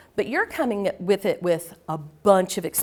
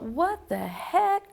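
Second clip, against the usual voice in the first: stunned disbelief; 7 semitones higher, 3 dB quieter, slower than usual. Retold or imitated. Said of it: "and I thought".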